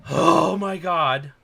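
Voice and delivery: big loud "oh", then slow release; drawn-out, relieved exhale into words; acted relief. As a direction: let it all out with slow relief. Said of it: "relieved. They have this sense of".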